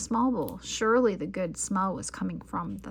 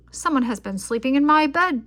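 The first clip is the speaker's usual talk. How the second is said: louder bellowing bear; 5 semitones higher, 9 dB louder than usual. A narrator's reading. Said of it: "Papa Bear bellowed".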